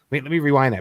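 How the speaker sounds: ordinary talk, nothing marked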